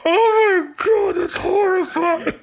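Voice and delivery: high breathless laughing voice; after laughing hard. Real, not acted. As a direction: squeal through the laughter. Still laughing at gross image.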